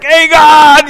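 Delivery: high, loud gleeful yell; joyful playground shout at dad; kids shouting on the ride. Retold or imitated. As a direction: whoop it like a kid spinning fast. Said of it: "We'd be like".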